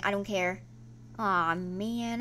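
ordinary talk, nothing marked

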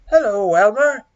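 big, loud lion greeting; storybook lion calling out hello. A narrator's reading. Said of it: "Hello, Lion".

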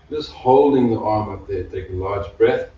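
ordinary talk, nothing marked

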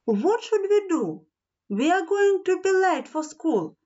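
high-pitched storybook worry; anxious, high-voiced dismay; nervous. A narrator's reading. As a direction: fret aloud, pitched high. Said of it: "said Nelly nervously".

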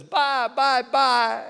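chirpy farewell up high; imitating sweet goodbye. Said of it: "she says".

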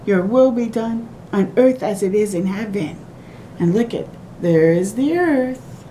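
usual speaking voice

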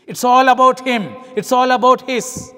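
loud, driving emphasis on about; emphatic. Real, not acted. Preacher driving home the first miler's selfishness, loud and emphatic. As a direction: hammer the point home. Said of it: "preacher's emphatic point".

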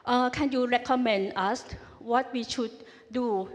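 usual speaking voice

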